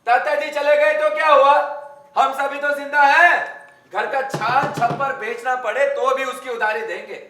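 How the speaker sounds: indignant shouting. Acted. Man outraged that a creditor demands old debt, indignant shouting. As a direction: thunder in defence of the family's honour.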